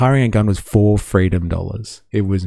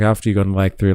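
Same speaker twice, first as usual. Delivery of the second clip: usual speaking voice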